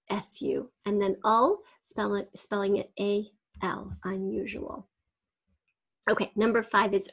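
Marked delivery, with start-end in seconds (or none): none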